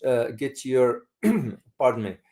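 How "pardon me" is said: ordinary talk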